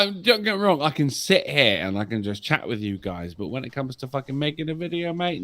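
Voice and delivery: normal talking voice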